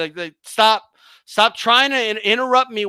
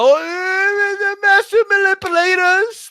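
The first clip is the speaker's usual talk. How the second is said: high mocking sing-song, pitched up; mocking whine; 8 semitones higher than usual. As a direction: sneer it in a high mock voice. Mocking opponents' claim.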